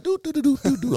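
high casual hum; carefree humming. Retold or imitated. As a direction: hum like a cop with no urgency. Cop strolling up casually.

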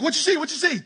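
high-pitched, excited questioning; hammed-up urgent curiosity; eager pestering. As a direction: press impatiently for the answer. Saul eagerly pesters the sorceress.

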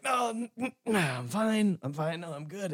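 put-on high, stammering boyish voice; high-pitched, stammered, trying to sound tough; embarrassed kid brushing it off. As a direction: squeak out a flustered "I'm fine" as a hurt kid. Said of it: "an eleven-year-old boy is like".

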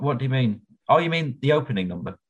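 normal talking voice

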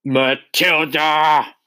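name roared slowly, high and drawn out; furious roar. A narrator's reading. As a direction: roar the pupil's name in accusation. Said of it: "she roared".

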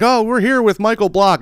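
high, bright mock announcer lift; TV host intro. TV broadcaster introducing Michael Block, mock announcer. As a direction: announce him like a sportscaster. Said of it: "and they're like".

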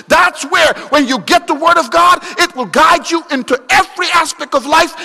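loud, high-pitched rapid-fire sermon delivery; full-force preaching. Acted. Preacher urging the church toward the Word, full-force preaching. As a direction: preach it hard, driving each promise home.

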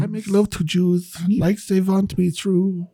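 high, slow sung mock German accent. Singing on friend's request, hammed-up German accent. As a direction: stretch the lyric out in fake German. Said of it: "in your best German accent".

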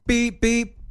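high-pitched mimicked bleeps; imitated censor beeps. Co-host describing the censored audio, high imitated beeps. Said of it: "The audio was background noise and".

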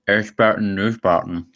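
band name through a mouthful of candy; mumbled past a candy bar; mouth full. Shown as a demonstration. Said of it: "I got a mouthful of candy bar. Let me try and say the band name".

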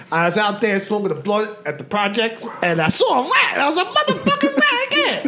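imitating rat comic.

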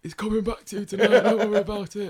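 imitating friend promising. Friend swearing to return the hat, mock earnest. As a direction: swear on your heart in a put-on mate voice. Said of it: "And he goes".

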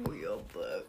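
very low, quiet sleazy voice; hammed-up low come-on; mock lustful man. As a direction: murmur it deep like a lecherous boyfriend. Mock-voicing man's lustful quote.

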